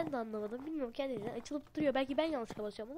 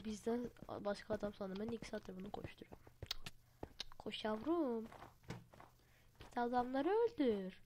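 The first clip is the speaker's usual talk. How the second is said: normal talking voice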